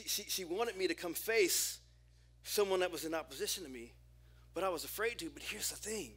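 usual speaking voice

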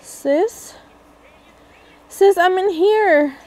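high, loud call. Real, not acted. Calling to her sister through the door, loud call.

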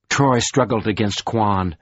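plain reading voice

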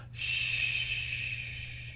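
long, soft shushing; calming, breath-only "shh"; hushing shh. Acted. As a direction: hush the storm with a drawn-out shh. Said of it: "Jesus just said".

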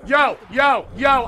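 loud, urgent calling out; real exasperated yelling; fed-up shout. Real, not acted. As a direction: shout it to stop everything. Calls a halt, fed up.

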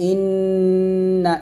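ইন্না with the doubled n hummed long through the nose; nasal ghunnah. Shown as a demonstration. Teacher gives ghunnah example, ইন্না long and nasal. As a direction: hold the n of ইন্না steady in the nose, then release. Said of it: "এভাবে".

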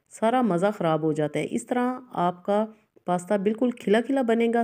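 ordinary talk, nothing marked

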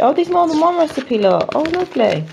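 high, delighted exclamations; gushing. Real, not acted. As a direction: gush happily over each find. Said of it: "I know I sound like I'm gushing".

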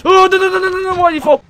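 shrieking high in panic; panicked scream. Real, not acted. Falls out of vent.

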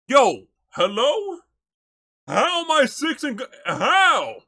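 excited shout. Real, not acted. Streamer yelling at a clip, real excited shout. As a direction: explode at what you see.

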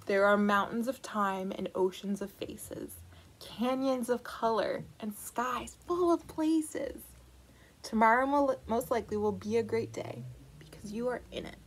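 lively picture-book read-aloud; reading to children. Acted. Librarian reading the book to children, lively storytelling. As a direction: read it to a room of little kids.

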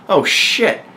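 high-pitched burst of exasperation; exasperated exclamation. Acted. King reacting to the mass slaughter, exasperated. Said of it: "in exasperation, exclaimed".

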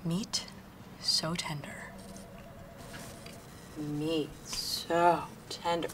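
slow, tentative practice; rehearsing the line. Real, not acted. Nervous new hire rehearsing her pitch slowly to herself. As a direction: try the line out slowly under your breath. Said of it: "come on, [name]".

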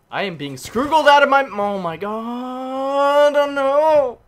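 high, loud, drawn-out cries; real panic building into a howl; panicked wailing. Real, not acted. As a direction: wail as it all falls apart. Panicking while being beaten.

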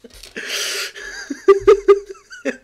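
a high, loud, helpless laugh; howling laughter. Real, not acted. Reader losing it over the fanfic text box, howling with laughter. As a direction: crack up until it's almost crying.

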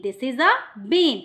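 "This is a bin" said slowly and evenly; slow teaching model. Shown as a demonstration. Teacher repeating a sentence to a child, slow. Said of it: "কিসের ছবি বললাম আমি".

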